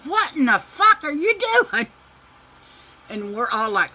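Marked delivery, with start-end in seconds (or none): 0.0-1.9 imitating mom screaming out the window, much higher and louder; mom's furious yell. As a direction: bellow it like an enraged mother catching her kids. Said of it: "She said".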